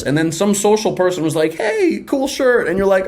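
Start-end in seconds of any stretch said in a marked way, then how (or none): 1.5-2.7 high, breezy hello; outgoing stranger greeting the shy listener, high and breezy; mock friendly stranger. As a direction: toss it off like the friendly guy at orientation.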